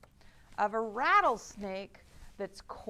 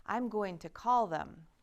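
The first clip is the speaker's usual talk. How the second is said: normal talking voice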